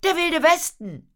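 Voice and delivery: put-on very high, loud boyish cry; excited shout. A narrator's reading. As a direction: shout it with wild enthusiasm. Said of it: "rief Otto". (begeistert gerufen, hoch und laut)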